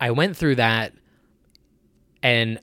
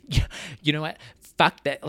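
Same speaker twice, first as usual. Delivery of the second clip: heated up; 4 semitones higher than usual. Real, not acted. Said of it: "like, heated up".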